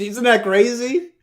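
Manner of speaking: high, loud mock disbelief; yelling off the phone. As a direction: holler it like a background heckler. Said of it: "yelling from, like, off the phone".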